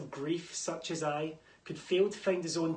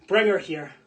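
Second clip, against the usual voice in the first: barked order, much louder; furious command; 5 semitones higher, 15 dB louder than usual. Acted. The king ordering his guards to fetch her, shouting. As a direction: roar it at the guards.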